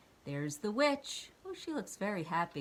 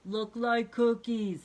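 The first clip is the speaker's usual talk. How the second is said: monster voice; 4 semitones higher, 7 dB louder, slower than usual. Acted. Cookie Monster speaks.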